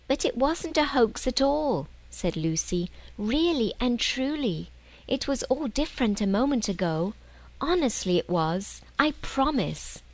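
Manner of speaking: put-on a small girl swearing she tells the truth; pleading young girl. Lucy begging Peter to believe the wardrobe was magic, pleading.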